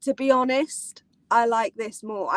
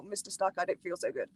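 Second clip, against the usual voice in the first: soft quote; 6 semitones lower, 10 dB quieter than usual. Retold or imitated. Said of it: "we're gonna do the whole".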